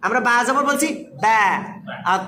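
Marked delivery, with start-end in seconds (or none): 1.2-1.4 "ব্যা" with a light, open front vowel; teacher's light "ব্যা"; thin letter. Shown as a demonstration. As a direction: say "ব্যা" thin, not rounded. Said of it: "একটা পাতলা করছি".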